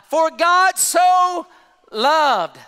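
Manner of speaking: "so" stretched high and loud, "loved" falling after a pause; stress on "so loved". Acted. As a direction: belt out "For God so", pause, then land "loved". Repeats verse with preaching emphasis.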